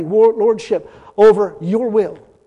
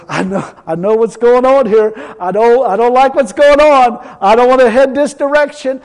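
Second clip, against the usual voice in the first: louder, strained pleading, voice pushed; loud pleading prayer; 4 semitones higher, 9 dB louder than usual. Retold or imitated. Preacher retelling his prayer to God, loud pleading. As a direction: pour it out to God like you can't take it.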